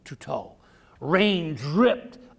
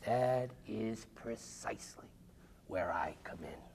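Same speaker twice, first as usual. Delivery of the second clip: hushed whisper; 6 semitones lower, 15 dB quieter than usual. A narrator's reading. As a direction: lean in and whisper it like a secret. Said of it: "she said".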